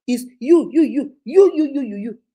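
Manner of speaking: loud, jabbing you you you at her; repeated accusing you, voiced loudly; mock accusing. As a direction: point the finger with each you. Women quoted blaming Akudo.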